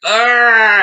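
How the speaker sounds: high, loud scream of pain; mock scream. Son attacked by bees, high loud scream. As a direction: shriek as the bees hit. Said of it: "his son's like".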